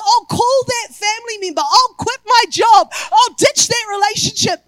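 loud, building preacher's cry; fiery sermon delivery; preaching. Acted. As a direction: preach it out at full force, pushing each vow. Preaching at full force.

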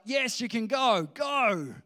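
acted ruler sending them off, fed up; exasperated surrender. Pharaoh finally gives in, retold.

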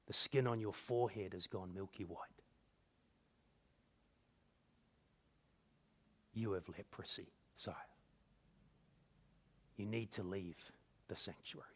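very low, hushed, with long careful pauses. A narrator's reading. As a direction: break terrible news almost under the breath. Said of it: "his voice was much quieter".

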